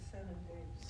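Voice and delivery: breathy whisper, no voice at all; feed the answer under your breath; hushed cue for the class; whispered prompt. Shown as a demonstration.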